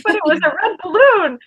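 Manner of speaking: high-pitched panicky whine; mock terrified. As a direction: whine like someone scared silly of a clown. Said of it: "absolutely terrified".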